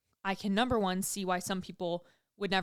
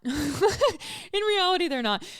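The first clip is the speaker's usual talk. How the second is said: high laughing repeat of in reality; 9 semitones higher, 6 dB louder, much slower than usual. Real, not acted. Admitting nobody's waiting on her, laughing. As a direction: laugh it out, much higher at first.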